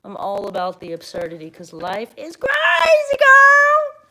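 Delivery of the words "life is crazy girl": soaring, very loud whoop; exuberant shout. Real, not acted. Telling a friend about loving absurdity, exuberant shout. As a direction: let it rip, high and loud.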